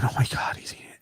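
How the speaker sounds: breathless whisper. Retold or imitated. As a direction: hush it so he can't hear you. Spying on coworker, whispering.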